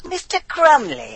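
high, drawn-out mock British accent; comic British accent. Cobra rehearsing for the prank call, comic British accent. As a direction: call the surname out in hammed-up British. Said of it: "Your British accent is fucking hilarious".

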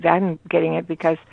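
normal talking voice

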